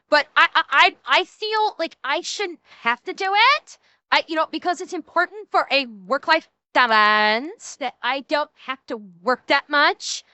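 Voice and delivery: mock entitled Valley Girl; whiny Valley Girl lilt, stretching key words; Valley Girl accent. Streamer mocking a millennial who won't work, Valley Girl accent. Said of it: "get away from the Valley Girl accent".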